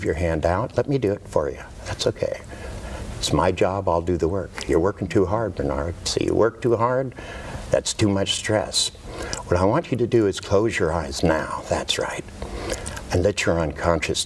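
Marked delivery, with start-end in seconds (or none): 1.8-2.4 soft reassuring whisper. Real, not acted. Hypnotist soothing subject. 11.7-12.2 hushed approving whisper. Real, not acted. Hypnotist deepening trance.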